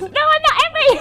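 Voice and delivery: put-on high childish protest through laughter; a small boy laughing, insisting he's fine; laughing kid voice. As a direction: play the giggling boy denying it. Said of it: "And the kid".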